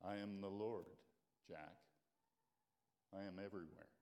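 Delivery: God reassuring Jack on the cliff, calm and low. Acted. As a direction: speak from above, serene. God speaks calmly to Jack.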